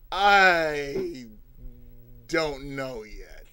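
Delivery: slow, trailing soft answer; unsure. Retold or imitated. Teacher unsure of the lesson, slow and fading. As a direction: drag it out uncertainly.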